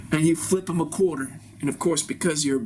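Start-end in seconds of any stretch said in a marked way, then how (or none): none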